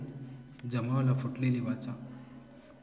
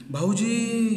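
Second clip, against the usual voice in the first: high, loud cry of alarm; sudden shout; 9 semitones higher, 4 dB louder, much slower than usual. A narrator's reading. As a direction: shout for help in a panic. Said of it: "ती ओरडली". (घाबरून मोठ्याने ओरडणे)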